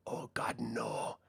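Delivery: whispered, taunting repeat of the plea; mocking whispered echo. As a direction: hiss it back at them as a cruel joke. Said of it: "Just repeating, parroting back".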